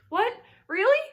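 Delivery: very high, loud astonished cry; surprised cry. A narrator's reading. Pig crying out in disbelief at rescue. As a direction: leap at the hope. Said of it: "cried Wilbur".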